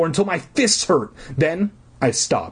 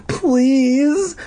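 tiny choked plea; 14 semitones higher, 4 dB louder than usual. Acted. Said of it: "in a tiny choked voice".

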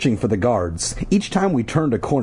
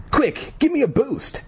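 high, urgent plea for help; lightly voiced girl crying out. A narrator's reading. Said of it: "Ashley cried".

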